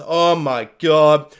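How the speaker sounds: slow, spent groan of words; worn-out disbelief; drawn-out exhaustion. Acted. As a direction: sigh it out slowly. Post-movie fan overwhelmed.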